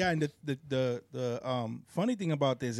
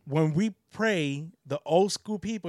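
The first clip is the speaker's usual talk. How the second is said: ordinary talk, nothing marked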